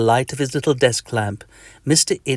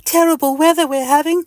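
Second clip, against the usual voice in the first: lonely; 4 dB louder, faster than usual. Acted. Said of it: "in a lonely voice".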